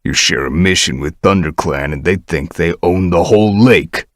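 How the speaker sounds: hiss. Acted. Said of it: "Crowfrost hissed".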